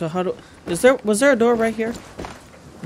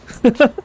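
laugh; 4 semitones higher than usual. Real, not acted. Laughs after dead silence.

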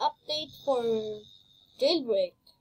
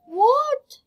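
high, loud, stunned what; shocked what; astonished what; 12 semitones higher, 10 dB louder than usual. Real, not acted. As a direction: yelp it in disbelief. Disbelief at game.

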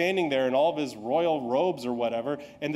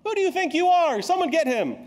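much higher, louder outraged shout; enraged king; 13 semitones higher, 5 dB louder than usual. Acted. Preacher acting the king yelling at the prophet, a much higher, louder shout. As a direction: explode at the upstart prophet.

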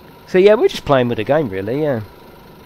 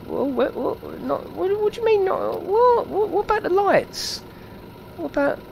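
very high squeaky disbelief; high, stammering mock dismay; baffled protest; 4 dB quieter than usual. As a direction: splutter in a high-pitched, baffled voice. Baffled that lights are missing.

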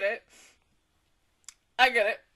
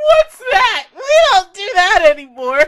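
high, much louder mocking yell; mock shout; 6 semitones higher, 18 dB louder than usual. Fan mocking the show's choice, loud crying shout. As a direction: yell it like the show's smug answer. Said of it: "It's like".

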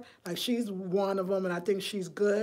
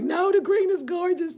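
high, louder acted objection; mock protest; 9 semitones higher, 5 dB louder than usual. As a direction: put on a raised-pitch protest. Voices her own second thought.

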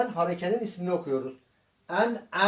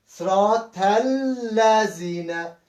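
slow reading aloud; much slower than usual. Shown as a demonstration. Teacher reads slowly for pupils.

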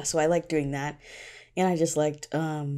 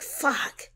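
exasperated curse; 11 semitones higher than usual. Retold or imitated. Despair at cliffhanger chapter.

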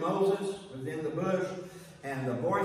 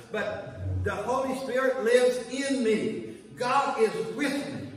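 rising preaching; 5 semitones higher, 4 dB louder than usual. Acted.

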